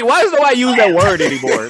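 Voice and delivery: high laughing question; laughing disbelief. Real, not acted. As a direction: squeal it through laughter.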